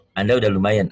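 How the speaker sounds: normal talking voice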